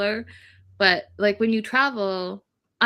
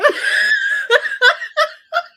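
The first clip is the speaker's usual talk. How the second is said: high, loud burst of laughter; helpless laughing fit; 8 dB louder than usual. Real, not acted. Retelling his reaction to co-host, laughing helplessly. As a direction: cackle uncontrollably. Cracking up telling story.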